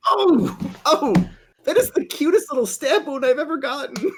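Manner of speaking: high-pitched gushing squeal; delighted squeal. Acted. Gushing over a tiny stab wound, delighted squeal. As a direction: squeal with laughing delight over something cute.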